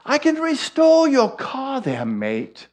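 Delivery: put-on retry the neighbour's line in an English accent that comes out Irish; Irish-tinged English accent. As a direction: redo the English accent, high, with Irish creeping in. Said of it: "my English comes out Irish".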